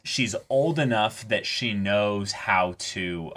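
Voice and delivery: usual speaking voice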